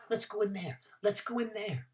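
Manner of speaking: pestering. Acted. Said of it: "she kept pestering me".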